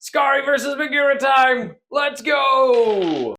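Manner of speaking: loud, high announcer-like battle cry; hyped-up shout. Real, not acted. Player launching Skari against Magira, excited shout. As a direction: shout it like a fight is starting. Hyping up the big fight.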